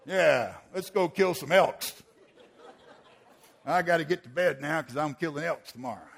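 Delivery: mock-manly hunter bravado; hammed-up macho cheer; macho bluster. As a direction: put on a hearty tough-guy hunter voice. Men cover emotion with macho bluster.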